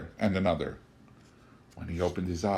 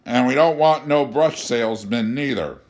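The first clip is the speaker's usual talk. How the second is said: put-on drunken rejection; 3 semitones higher, 7 dB louder than usual. A narrator's reading. Drunk Art repeats his rejection.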